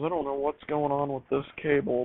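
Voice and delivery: ordinary talk, nothing marked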